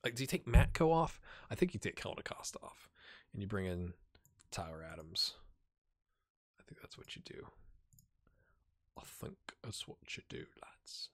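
unvoiced whispered musing. Real, not acted. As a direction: plot the change under your breath.

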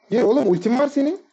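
high, loud urging yell; excited shout. Real, not acted. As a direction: yell at your teammate to go in. Urging teammate to engage.

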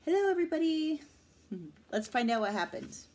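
plain reading voice

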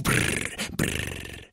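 quiet rolled-lip shudder; voiceless brrr of revulsion, fading. Acted. Shuddering at the imagined creatures.

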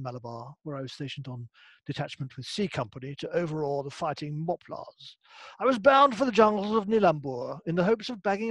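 put-on lightly done plummy old British officer accent; a hint of a Ripping Yarns British officer accent. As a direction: read it like a colonial British officer from the boys' own magazines. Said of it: "reading it in that accent".

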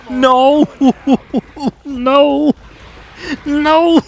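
high, loud wail of despair; loud, high-pitched "no" of disbelief; anguished cry. Real, not acted. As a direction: cry out in gamer agony. Agony at losing in game.

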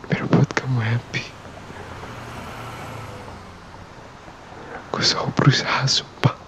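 put-on soft, breathy boyfriend whisper close to the mic; ASMR whisper. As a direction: whisper to her like she's right beside you.

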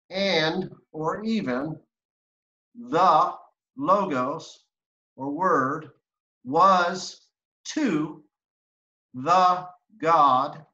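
careful literal rendering read aloud; slow word-by-word reading. Shown as a demonstration. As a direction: spell out the translation word by word. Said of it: "Can you read the English part of that now?".

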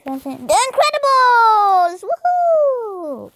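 drawn-out, rising excited cheer. Real, not acted. Kid gamer cheering on the video, excited cheer.

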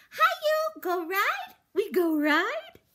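put-on very high, loud little-girl chirp; toddler voice. A narrator's reading. Toddler begging the roach for a ride. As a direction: bounce it like an excited two-year-old.

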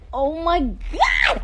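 loud, high, stretched exclamation; startled outcry. Real, not acted. Streamer hit by another mishap on her live, crying out. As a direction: cry out and stretch the last word.

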